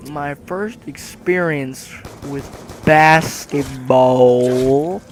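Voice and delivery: normal talking voice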